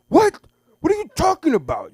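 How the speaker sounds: very high, loud yelp; mock shocked kid. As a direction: screech it in outrage. Son shocked.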